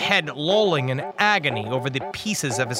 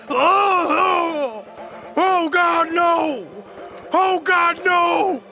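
high, agonized wailing scream; anguished high howl; screamed pleading; 12 semitones higher, 4 dB louder, much slower than usual. A narrator's reading. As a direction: scream and plead in drawn-out agony. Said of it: "Branton screamed and threw himself face down".

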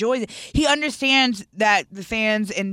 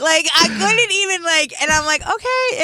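flustered squeal; 8 semitones higher, 4 dB louder than usual. Retold or imitated. Flustered retelling of her reaction.

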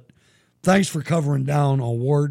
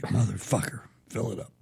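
low, soft grumbled curse under the breath; muttered under breath; 5 semitones lower, 10 dB quieter than usual. Retold or imitated. Gas attendant cursing the rich customer under his breath, quietly. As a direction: mutter it so the customer can't hear. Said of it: "I'm like".

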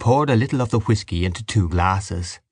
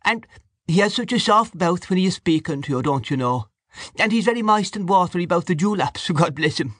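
high, oily, lip-smacking mimicry of the hotel keeper; mock the smarmy innkeeper, much higher; hotel keeper impression; 10 semitones higher than usual. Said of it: "began to speak with the voice of the hotel keeper".

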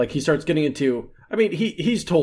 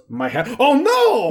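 hammed-up fake dismay, higher and slower; exaggerated oh no, much higher and slower; mock dismay; 9 semitones higher, 4 dB louder, slower than usual. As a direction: play a joke cry of alarm over a lost hat. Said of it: "clearly an intentional joke".

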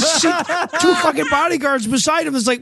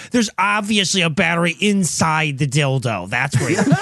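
lower, sniffy know-it-all voice; snooty guy; 8 semitones lower than usual. Pretend. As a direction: scoff like a smug skeptic. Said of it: "like a snooty guy".

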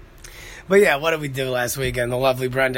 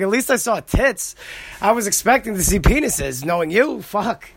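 put-on teasing girlfriend; 6 semitones higher than usual. [name] voiced teasing.

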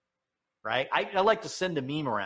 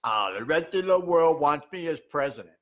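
a hint of Joe Biden bragging; Biden impression; 3 dB louder than usual. Host mocks Biden's boast with a light impression. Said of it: "So when Joe Biden says".

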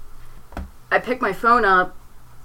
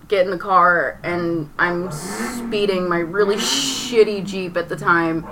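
ordinary delivery

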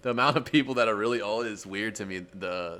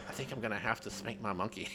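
hushed cartoon-character impression; Beavis doing ASMR; 9 dB quieter than usual. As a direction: softly imitate a goofy ASMR voice. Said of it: "time they're doing A S M R".

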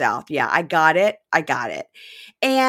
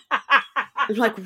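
ordinary talk

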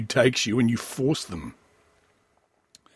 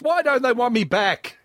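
higher whiny plea; mock whine; 10 semitones higher than usual. Host pleading to be asked back, mock whine. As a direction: whine it like a jilted child.

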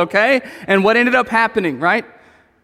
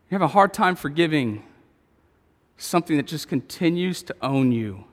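soft closing appeal; 6 semitones lower, 6 dB quieter than usual. Acted. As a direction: bring it down gently to a hushed invitation.

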